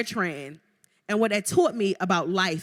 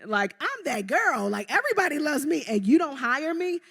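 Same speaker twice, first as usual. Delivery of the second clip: high-pitched, incredulous self-quote; indignant disbelief; 7 semitones higher than usual. Retold or imitated. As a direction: act out being baffled and affronted. Acting out her disbelief at rejection.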